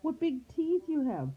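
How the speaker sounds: put-on very high alarmed girl voice; high girlish alarm; frightened little girl. A narrator's reading. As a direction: marvel in high-pitched fright. Girl sees the sharp teeth.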